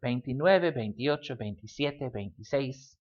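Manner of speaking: numbers rattled off, each as one joined word; in a rush. Shown as a demonstration. Teacher showing how people say them, in a rush. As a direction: run the twenties together quickly, no gap inside a number. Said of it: "gonna say them in a rush".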